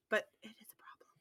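hushed, unvoiced whisper; whispered aside. Ross quietly admitting it to himself, whispered. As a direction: drop to a secretive whisper. Muttered aside in acted exchange.

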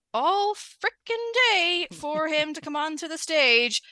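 slow, mock-outraged grumble; exasperated emphasis. Real, not acted. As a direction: stretch it out to show the endless wait. Complains about her long wait.

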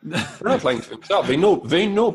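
laughing as you push back; laughing insistence. Real, not acted. Laughing while insisting to cohost.

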